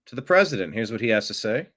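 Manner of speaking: ordinary delivery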